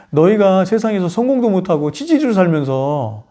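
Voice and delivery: ordinary talk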